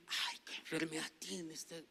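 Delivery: soft, acted dismay; a retold gasp of concern, hushed. Pastor re-enacting his reaction to a sick child, hushed mock alarm.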